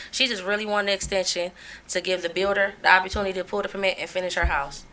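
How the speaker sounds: ordinary talk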